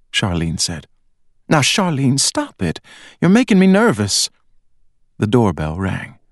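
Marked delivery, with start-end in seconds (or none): 1.5-4.3 nervous mother. A narrator's reading. Mother scolds, growing nervous.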